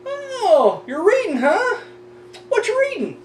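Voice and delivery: high, sing-song mock of a nosy coworker. Mocking a coworker who interrupts his reading, high and sing-song. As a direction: ham up the pestering question in a high voice. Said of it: "the same fucking question".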